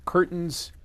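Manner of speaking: normal talking voice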